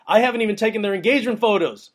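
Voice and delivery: high, loud and fast; an excited, speedy boast; amazed excitement. Real, not acted. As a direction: rush it out in disbelief. Amazed at clients booking.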